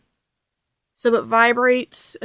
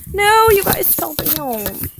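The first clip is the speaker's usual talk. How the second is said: surprised yelp; 7 semitones higher, 7 dB louder, slower than usual. Real, not acted. Scolding fallen items.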